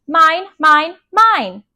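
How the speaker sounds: put-on loud, high toddler protest; high, insistent little kid; possessive toddler. A narrator's reading. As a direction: claim it like a stubborn small child. Toddler clutches book to his chest.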